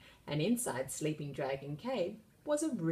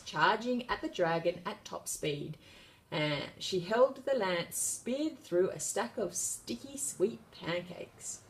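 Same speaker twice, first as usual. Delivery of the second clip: plain reading voice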